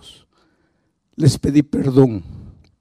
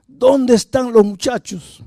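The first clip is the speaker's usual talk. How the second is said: much higher, slow, menacing repeat; angrier repeated demand; 10 semitones higher, 3 dB louder than usual. Retold or imitated. Violent father repeating the demand to his wife, slowly and furiously. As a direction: drag out the threat word by word.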